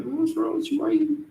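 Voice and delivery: high, disbelieving mock voice; baffled coworker. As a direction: sound like a friend who can't believe what he's hearing. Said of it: "Brian will look at you like".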